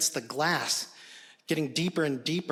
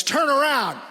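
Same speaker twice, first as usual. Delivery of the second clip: sharp barked command, high and loud; shouting; 10 semitones higher, 7 dB louder than usual. Retold or imitated. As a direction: yell it down the stairs. Dad yelling from stairwell.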